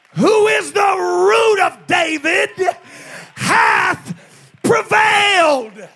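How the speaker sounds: very loud, high, shouted sermon; full-force preaching. Real, not acted. Preacher tells the congregation the Lion has prevailed, full-force shouting.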